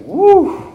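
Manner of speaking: high, loud whoop of amazement; woo exclamation. Retold or imitated. Man feeling chills in the chapel, high loud whoop. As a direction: let out a sudden high whoop. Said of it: "I was just like".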